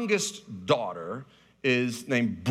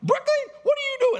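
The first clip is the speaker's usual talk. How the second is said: very high, squeaky mock of a scolding dad; a kid mocking her father's scolding; child imitating dad; 3 dB louder, faster than usual. Daughter mimicking dad's scold in a squeaky mock voice. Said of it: "And you were like".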